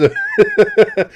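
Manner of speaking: high wheezing laugh; breathless high cackle; cracking up. Real, not acted. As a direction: break into helpless high laughter. Cracking up again.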